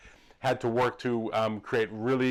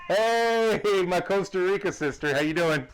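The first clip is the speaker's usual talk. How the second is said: excited greeting; 9 semitones higher, 5 dB louder than usual. Real, not acted. Greeting coworker on arrival.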